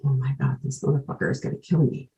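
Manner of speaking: retold thought, said softly under the voice; quiet inner-voice quote; hushed dread. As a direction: drop the volume for a dawning realization. Retold dread on smelling gasoline.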